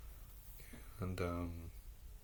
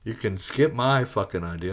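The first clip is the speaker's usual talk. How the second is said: ordinary talk, nothing marked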